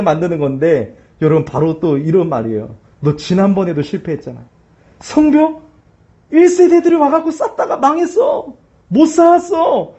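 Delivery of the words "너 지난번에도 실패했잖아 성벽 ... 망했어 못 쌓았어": higher sneering voice of Satan; mocking Satan. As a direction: taunt the believer as the devil, pitched up. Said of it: "사단은 조롱하는 거예요". (사탄이 신자를 조롱하는 높은 목소리)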